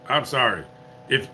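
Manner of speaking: ordinary talk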